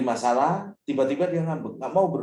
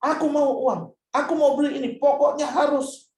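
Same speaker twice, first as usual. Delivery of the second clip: put-on much higher, louder whiny child; child shouting demand; 12 semitones higher, 4 dB louder than usual. Child yelling demands at parent, hammed-up whiny shout. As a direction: stamp your foot like a spoiled kid. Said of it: "Ketika dia berteriak".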